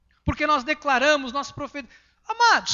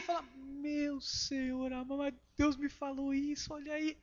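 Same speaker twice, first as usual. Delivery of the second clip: hushed aside; 12 dB quieter, slower than usual. Retold or imitated.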